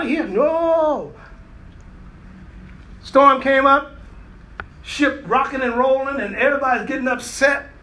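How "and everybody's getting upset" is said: ordinary delivery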